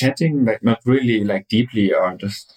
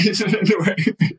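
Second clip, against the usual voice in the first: laugh; 11 semitones higher than usual. Real, not acted. Laughs at her own mock gushing.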